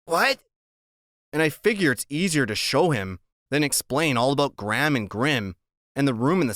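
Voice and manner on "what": put-on short high kid's what; puzzled little boy voice; high childlike confusion; high puzzled Freak. A narrator's reading. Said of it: "He goes".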